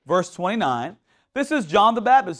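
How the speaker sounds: ordinary talk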